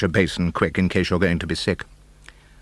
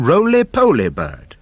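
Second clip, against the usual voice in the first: plain reading voice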